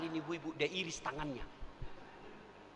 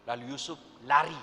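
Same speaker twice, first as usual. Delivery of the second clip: ordinary talk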